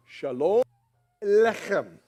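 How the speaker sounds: Shalom Aleichem called out loud, Hebrew ch in Aleichem; Hebrew greeting. Shown as a demonstration. Preacher teaching the church a Hebrew greeting, loud. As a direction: lead the crowd in Shalom Aleichem. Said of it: "Please repeat after me".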